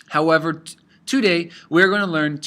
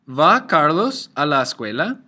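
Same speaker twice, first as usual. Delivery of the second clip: "Va Carlos a la escuela?" with the voice going up at the end; rising question intonation; 3 dB louder than usual. Shown as a demonstration. Teacher modelling an inverted question with a rise at the end. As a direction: ask it, lifting "escuela". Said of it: "you may hear a rise, a rising intonation".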